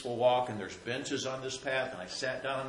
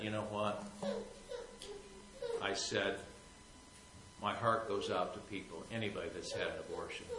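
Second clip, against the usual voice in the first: softer, choked-up, close to crying; near tears; 4 semitones lower, 9 dB quieter than usual. Real, not acted. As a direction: speak gently while holding back tears. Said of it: "I almost began to cry".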